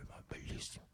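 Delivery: breathless awed whisper; soft whisper of mock delight. Real, not acted. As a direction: breathe it in hushed wonder. Awed aside after promise.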